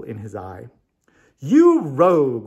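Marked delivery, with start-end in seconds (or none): 1.4-2.4 loud, slow, hearty roar; a fond, booming "You rogue!". A narrator's reading. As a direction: bellow it with affection. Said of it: "roared the wizard".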